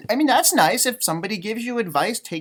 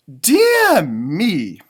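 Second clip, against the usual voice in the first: Owl's voice; 2 semitones lower, 7 dB louder than usual. Acted. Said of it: "said Owl".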